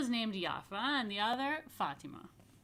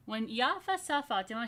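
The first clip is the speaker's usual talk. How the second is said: ordinary delivery, nothing marked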